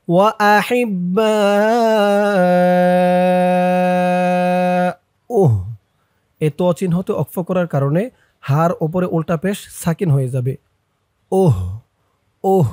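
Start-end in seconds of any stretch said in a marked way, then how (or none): 0.1-5.5 baa sustained very long; slow chant, hold baa then close on uh; four-alif stretch. Shown as a demonstration. Teacher showing the four-alif sign, baa stretched. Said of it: "এই যে চার আলিফের চিহ্ন". 11.3-12.7 final h left vowelless; stopped ending uh. Shown as a demonstration. Teacher showing the pause form, uh with silent-vowel h. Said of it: "এমন উচ্চারণ হবে".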